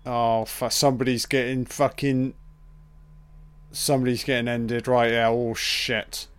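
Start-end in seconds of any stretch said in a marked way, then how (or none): none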